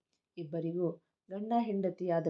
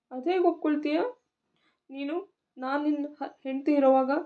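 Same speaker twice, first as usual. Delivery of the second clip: hurt wife, higher and louder; angry; 7 semitones higher, 4 dB louder than usual. Acted. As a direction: demand how he could. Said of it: "ಕೋಪಕ್ಕೆ". (ಕೋಪದಿಂದ ಎತ್ತರದ ಧ್ವನಿಯಲ್ಲಿ ಪ್ರಶ್ನೆ)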